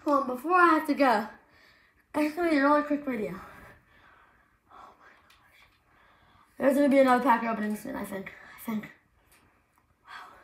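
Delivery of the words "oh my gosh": breathless whispered disbelief; awed whisper. Real, not acted. Kid whispering in amazement at the cards. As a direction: whisper it, stunned. Stunned after pull.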